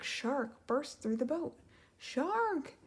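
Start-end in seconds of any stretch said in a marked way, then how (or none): 2.0-2.7 high alarmed cry; shout. Acted. Flounder shouting a warning to Ariel, alarmed. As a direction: warn her of the shark. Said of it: "Flounder shouted".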